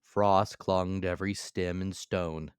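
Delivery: ordinary delivery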